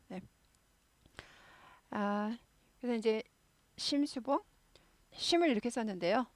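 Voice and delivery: ordinary delivery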